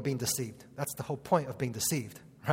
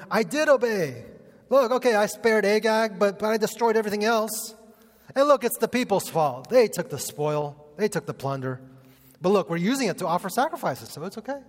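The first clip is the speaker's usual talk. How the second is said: higher, louder whiny self-justifying Saul; whiny excuses; 6 semitones higher, 4 dB louder than usual. Retold or imitated. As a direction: plead your case like a caught child. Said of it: "how does Saul respond?".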